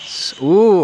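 excited. Real, not acted. Excited gacha pull reaction.

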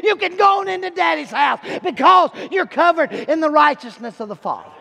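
rising hellfire sermon, voice straining high; preaching at full force. Acted. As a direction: belt it out like a revival preacher. Preacher builds to a climax.

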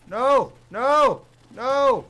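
loud, high, desperate no; real panic shouting; panicked screams. Real, not acted. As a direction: scream as the horde breaks through. Zombies overrun him, panics.

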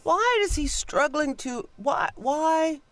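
high baffled wail of why. Retold or imitated. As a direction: plead in disbelief. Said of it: "I'm like".

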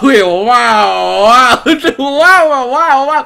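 high, loud wows through laughter; excited wowing. Real, not acted. Streamer gawking at the game's picture, excited wowing.

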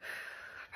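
soft, slow exhale; breathing practice. Real, not acted. Pregnant vlogger catching her breath, soft controlled exhale. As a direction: blow the breath out gently to recover. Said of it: "Probably should practice this".

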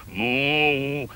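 low, drawn-out moo; cow's moo. Acted. Moo cow taking the slide, low mooing.

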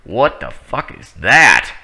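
ordinary delivery

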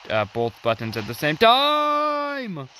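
high, loud excited cry. Real, not acted. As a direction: burst out with the discovery. Excited discovery mid-game.

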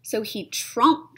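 ordinary delivery

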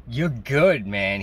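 imitating an impressed boss; impressed praise. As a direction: retell it as a boss who is won over. Boss delighted by stand-up video.

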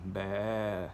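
drawn-out "bah" like a sheep; sheep bleat. Acted. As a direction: do a casual sheep impression. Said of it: "sheep impression".

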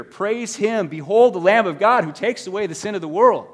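loud, high, impassioned sermon call; fervent preaching. Acted. Pastor urging the congregation to behold the Lamb, full-force preaching. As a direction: preach it out to the whole room.

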